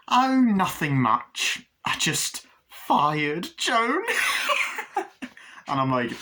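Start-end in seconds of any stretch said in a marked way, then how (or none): 0.1-4.0 high, slow, drawn-out mock sweetness; gleeful villain. Glenda's gleeful line, acted. 4.1-5.3 very high, breathless imitated cackle; villain cackle. Villain laugh after the quote.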